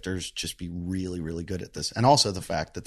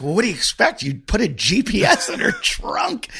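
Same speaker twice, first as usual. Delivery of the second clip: exasperated scolding; 5 semitones higher, 4 dB louder than usual. Retold or imitated. Retold scolding of friend.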